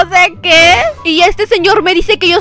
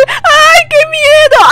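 high, shrieking ay of mock fear; mock fright; 10 semitones higher, much slower than usual. Girl squealing at a creepy man's invitation, mock scared.

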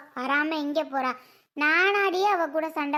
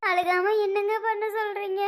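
tearful, higher-pitched protest; அழுகை; 4 semitones higher, 3 dB louder than usual. Acted. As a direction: answer back through tears. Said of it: "சுந்தரி, ஏன் அழுகுற". (அழுதுகொண்டே கேட்கும் குரல்)

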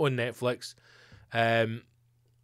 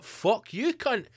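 mock outrage; 9 semitones higher, much slower than usual. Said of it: "and I'm like".

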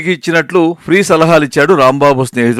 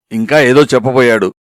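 ordinary delivery, nothing marked

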